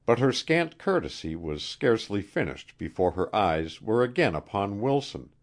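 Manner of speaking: ordinary delivery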